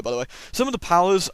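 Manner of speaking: usual speaking voice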